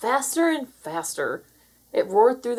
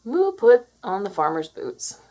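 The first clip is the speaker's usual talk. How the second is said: usual speaking voice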